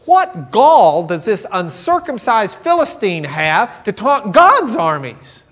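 loud, slow, high indignation; indignant. Retold or imitated. David indignant at the Philistine, loud and slow. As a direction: demand it with righteous offense.

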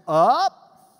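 UP swept upward, loud; rising pitch. Shown as a demonstration. Teacher demonstrates the accent, rising UP. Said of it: "this accent meant you go up".

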